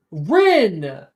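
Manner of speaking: indignant shout across the water; yelling out. Acted. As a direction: yell out toward her. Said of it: "Levi will yell out toward her".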